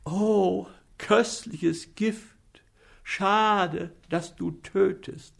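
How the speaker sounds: a lad crying out over sweet poison; exclaiming. A narrator's reading. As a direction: savour the poison with mock rapture. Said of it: "ruft er aus".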